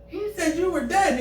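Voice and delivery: wife finding out she was said to be dead, loud and high; shocked outrage. Retold or imitated. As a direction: throw the lie back at him. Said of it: "she finds out".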